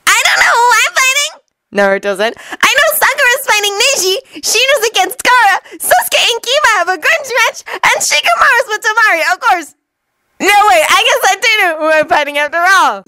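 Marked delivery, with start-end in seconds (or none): 0.1-1.3 very high, quick squeaky voice; high-pitched, rapid excitable boy's voice; Naruto. A narrator's reading. Naruto to the group before the matches, high squeaky voice. 2.7-13.0 very high, quick squeaky voice; high-pitched voice rattling off the matchups; Naruto. A narrator's reading. Naruto working out who he fights, high squeaky voice.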